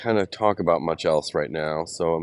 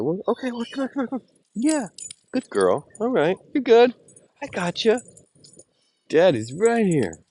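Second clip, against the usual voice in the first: much higher, sing-song coaxing. Real, not acted. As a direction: coax a scared puppy tenderly. Coaxing his trembling dog.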